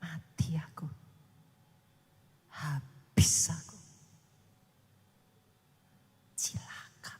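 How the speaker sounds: low slow muttered despair, fading to a whisper; despairing self-talk. Retold or imitated. Preacher imitates a hopeless believer, despairing mutter. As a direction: sink into hopeless self-pity. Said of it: "Kalau tiap hari saudara bilang".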